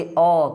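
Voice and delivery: अ as a lone open 'aw' sound, pitched low. Shown as a demonstration. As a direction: demonstrate अ, an open vowel, not 'ay'. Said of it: "इसको हम अ पढ़ेंगे". (अ की खुली ध्वनि अलग से बोलें)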